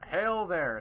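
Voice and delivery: high, loud hailing call; yelling across distance. Acted. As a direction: call out to orcs far off. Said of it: "they're yelling at each other".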